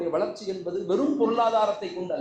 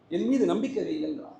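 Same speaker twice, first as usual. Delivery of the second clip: usual speaking voice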